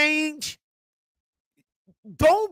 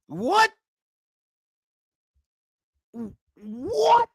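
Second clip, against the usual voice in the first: disbelieving what, rising much higher; baffled what; 4 semitones higher than usual. Retold or imitated. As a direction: react like you can't believe it. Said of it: "and I'm like".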